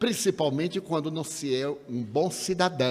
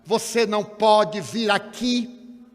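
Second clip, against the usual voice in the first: slow, louder, higher call from across the room; retold shout; 6 semitones higher, 6 dB louder, much slower than usual. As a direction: shout it slowly across the hall, stretching each word. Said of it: "Ela então gritou de lá".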